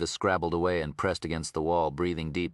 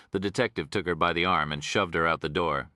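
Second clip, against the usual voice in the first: plain reading voice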